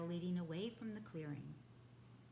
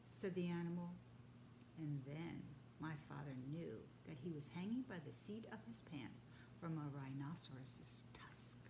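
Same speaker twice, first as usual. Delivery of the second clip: ordinary delivery, nothing marked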